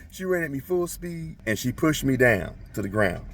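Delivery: normal talking voice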